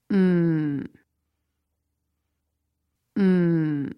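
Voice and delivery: mm low, sinking to the bottom of the range; low falling tone. Shown as a demonstration. Teacher demonstrating the lowest tone. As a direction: drop mm low and let it settle. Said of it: "low and flat at the bottom".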